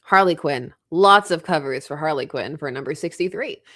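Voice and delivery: ordinary delivery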